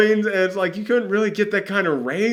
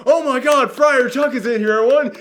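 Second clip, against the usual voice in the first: high-pitched, overblown fan amazement; mock excitement; 10 semitones higher, 3 dB louder than usual. Podcaster mocking a big casting reveal, mock excitement. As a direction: fake being astounded by an obvious reveal. Mocks hype over predictable casting.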